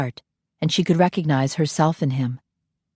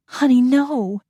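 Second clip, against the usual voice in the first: tender; 8 semitones higher, slower than usual. Acted. Nanny comforting the boy, tender. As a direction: reassure the little boy.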